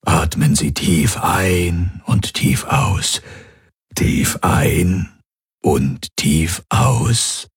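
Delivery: calm doctor. A narrator's reading. Doctor calming woman in labour.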